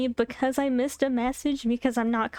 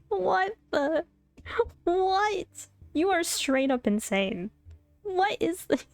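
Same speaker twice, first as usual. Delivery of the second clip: shocked disbelief; 8 semitones higher, slower than usual. Retold or imitated. Stunned by his reply.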